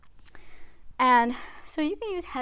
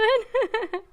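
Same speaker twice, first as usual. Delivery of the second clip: breathless laugh mid-rep; strained laugh; 10 semitones higher, 7 dB louder than usual. Real, not acted. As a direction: laugh through the effort. Laughing mid-count during workout.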